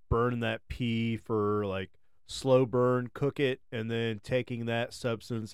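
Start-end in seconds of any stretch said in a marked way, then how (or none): none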